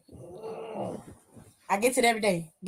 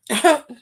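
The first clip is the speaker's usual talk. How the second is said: short laugh; 3 semitones higher, 7 dB louder than usual. Real, not acted. Laughing at own joke.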